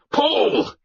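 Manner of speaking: high-pitched roar to rowers; shipmaster's yelled command; roared order. Acted. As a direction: roar it out to drive the oars. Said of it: "Ralla roared".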